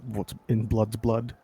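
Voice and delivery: ordinary talk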